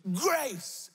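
high, falling cry; mild acted shout. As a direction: lift the pitch as if told to shout. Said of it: "I said shout".

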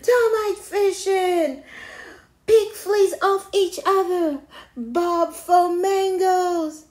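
high, loud excited calls; everyone shouting out. A narrator's reading. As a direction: shout suggestions like an eager crowd. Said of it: "Everyone answered at once".

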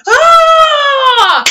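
long, high, loud cheer; a crowd roaring approval. Retold or imitated. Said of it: "you do get a resounding".

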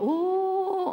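high wavering "oh" of eerie music; spooky music imitation. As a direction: imitate the scary soundtrack. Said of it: "all the music".

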